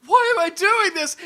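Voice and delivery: high, very loud cry of horror; panicked shout. Acted. Game master as the man aiming at his wife, panicked shout. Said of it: "he points his gun at his wife and he goes".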